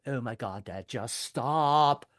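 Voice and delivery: groaning plea with a long-drawn last word; mortified daughter. Acted. Daughter begging Dad to quit, drawn-out exasperated whine. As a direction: stretch it out in imitated embarrassment.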